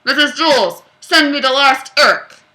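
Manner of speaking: loud, booming intercom call; loud loudspeaker summons; over the loudspeaker. Acted. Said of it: "voice resound over the loudspeaker".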